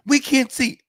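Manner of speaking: high, loud mocking kid voice; bratty kid mimic. Kid mocking the complaining fan, high and loud. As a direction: echo him like a snotty child.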